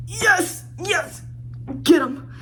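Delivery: delighted fan squeal; gleeful cheering. Real, not acted. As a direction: cheer her on in a high voice. Cheering on the heroine's rejection.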